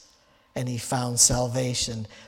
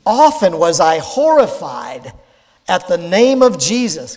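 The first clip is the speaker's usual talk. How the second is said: fervent preached quote; 5 semitones higher, 7 dB louder than usual. Retold or imitated. Preacher quoting Luther's confession to the congregation, fervent and loud. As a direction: read it out loud and high with rising fervour, like a confession. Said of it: "He said this".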